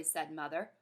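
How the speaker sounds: ordinary delivery, nothing marked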